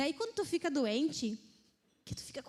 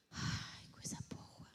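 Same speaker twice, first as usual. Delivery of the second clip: whisper; 14 dB quieter, slower than usual. Acted. Savoring relief after pain.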